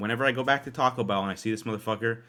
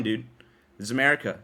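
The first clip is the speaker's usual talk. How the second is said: normal talking voice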